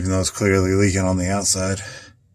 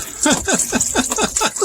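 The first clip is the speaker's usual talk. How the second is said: laugh. Real, not acted. Laughs at water in engine.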